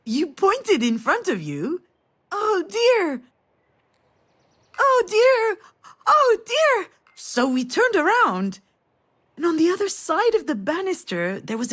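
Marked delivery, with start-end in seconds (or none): 2.3-6.9 put-on high, loud toddler cries, repeated; imitating a child screaming. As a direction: squeal it like a startled little girl.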